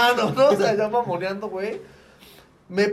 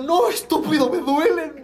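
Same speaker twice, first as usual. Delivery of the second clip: annoyed protest; 7 semitones higher, 6 dB louder than usual. Retold or imitated. Arguing with friends.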